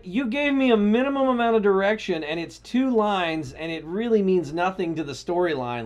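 retold outraged protest; indignant comeback. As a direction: argue back, loud and exasperated at first. Retelling indignant comeback to client.

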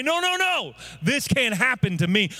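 high, defiant refusal; annoyed defiance. Shown as a demonstration. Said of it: "Get annoyed".

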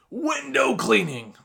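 WINDOW CLEANING stretched out slow and high; big-letter announcement. Shown as a demonstration. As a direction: draw out "window cleaning" like a giant sign. Acts out big letters on sign.

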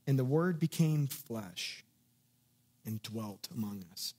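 slow down on "flesh", then let "dwelt among us" drop soft and low; slow meditative reading. Shown as a demonstration. Preacher shows listeners how to meditate on a verse, slow and pondering. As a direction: weigh each phrase, pausing after "flesh". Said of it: "reading that verse and focusing on different words".

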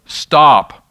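loud, firm traffic-stop order; firm command. Acted. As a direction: call it out like a policeman halting cars. Policeman giving a traffic command.

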